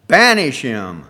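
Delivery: loud angry shout; angry cry. A narrator's reading. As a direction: demand it furiously. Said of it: "angrily cry out".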